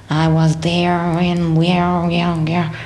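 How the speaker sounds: slow, drawn-out mock American singer; stretched fake American accent; put-on American accent. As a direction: imitate an Irish singer faking American. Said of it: "there's this accent and you're going".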